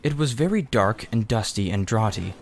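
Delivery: ordinary delivery, nothing marked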